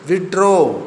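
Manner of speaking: "withdraw" with emphasis on "draw"; stress on DRAW. Shown as a demonstration. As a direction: lean on the second part, "draw", in "withdraw". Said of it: "Draw has emphasized here".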